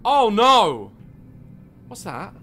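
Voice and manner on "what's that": hushed. Real, not acted. Hushed repeated question.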